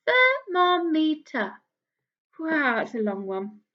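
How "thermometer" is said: "Thermometer" slow, loud, each syllable marked; slow counted syllables. Shown as a demonstration. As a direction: sound out "Thermometer" slowly for counting. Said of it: "Four syllables".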